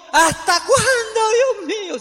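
high, drawn-out loud cry to God; grito de clamor. Acted. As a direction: cry out at full voice, stretching the plea. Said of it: "Gritó". (grito de súplica, fuerte, agudo y alargado)

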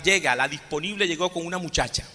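ordinary delivery

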